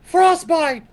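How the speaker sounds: mild mock scream of a spell name; half-hearted spell shout; screamed battle cry. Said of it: "I scream".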